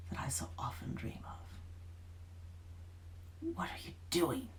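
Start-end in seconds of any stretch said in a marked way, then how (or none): 3.5-4.5 rasped. A narrator's reading. Witch rasping at the Duke's son. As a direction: rasp it out through bared teeth. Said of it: "she rasped".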